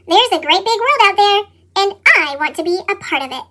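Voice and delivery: plain reading voice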